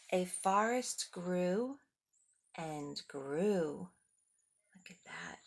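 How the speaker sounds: slow storybook narration; reading to children. A narrator's reading. Reading the forest scene to the kids, slowly. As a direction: let the forest grow slowly. Reads picture book aloud.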